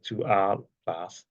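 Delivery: ordinary talk, nothing marked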